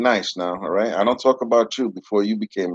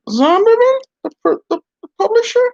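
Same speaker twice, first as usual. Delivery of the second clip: much higher, louder puzzled puppet; a startled dummy questioning; 5 dB louder than usual. Acted. Puppet surprised by name.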